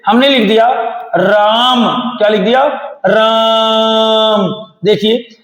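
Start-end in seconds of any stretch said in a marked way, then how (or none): 3.1-4.7 राम with the आ held very long; prolonged vowel. Shown as a demonstration. Teacher showing a pluta vowel, holding राम long. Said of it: "ज्यादा देर तक नाम जपते रहते हैं".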